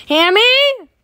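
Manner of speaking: furious yell of the name, high and loud; angry shout. Acted. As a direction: bellow Hammy's name in outrage. Yelling at Hammy over money.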